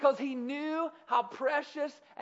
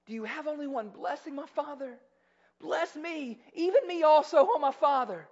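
high-pitched plea, building louder; pleading, lifted voice; 6 semitones higher, 5 dB louder than usual. Acted. Esau begging his father for a blessing, acted pleading. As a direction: beg with a raised voice, without real weeping. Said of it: "Esau lifted his voice and wept".

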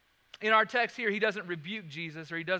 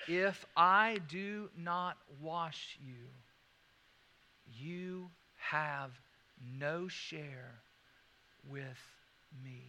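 slow, weighty repeat; 4 semitones lower, much slower than usual. Shown as a demonstration. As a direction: lay the verse down slowly, word by word. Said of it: "Now let me say that again".

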